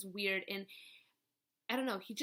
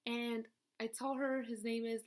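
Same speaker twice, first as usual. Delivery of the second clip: ordinary talk, nothing marked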